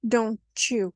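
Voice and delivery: "don't you" joined as "donchoo"; linked "don't you" with ch; assimilated. Shown as a demonstration. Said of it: "it changes completely to don't you".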